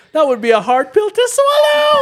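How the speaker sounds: chipmunk-high squeaky voice; pitch up voice. Retold or imitated. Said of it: "he uses the pitch up voice".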